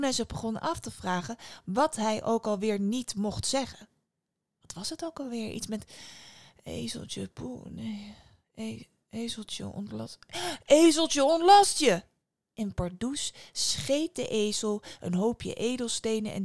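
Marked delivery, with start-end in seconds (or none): 4.6-10.2 hushed, halting thinking aloud; muttering to oneself, unsure; hesitant recalling. A narrator's reading. Boy trying to recall forbidden words. 10.6-12.0 loud, high burst of remembering; sudden excited shout; triumphant recall. A narrator's reading. Boy suddenly remembers the magic words.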